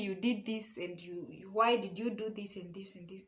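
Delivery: ordinary talk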